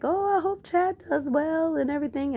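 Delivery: high, sweet and doting, lightly done; sweet family voice. Retold or imitated. Cousin's family rooting for Chad, high sweet voice. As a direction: coo it like a proud relative. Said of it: "it was so cute 'cause they were still like".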